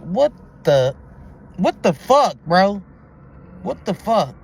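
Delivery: a stunned, loud what the fuck; shocked outburst. Real, not acted. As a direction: blurt it out in real shock. Shocked by the pregnancy claim.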